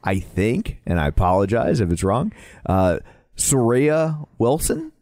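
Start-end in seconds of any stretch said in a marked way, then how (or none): 3.5-4.9 slow, questioning lift on the name; tentative pronunciation attempt. Real, not acted. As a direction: sound out the name carefully, unsure. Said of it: "I will attempt to pronounce it".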